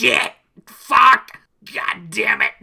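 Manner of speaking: loud frustrated cursing; frustrated swearing. Acted. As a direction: curse loudly, furious. Acted frustration outburst.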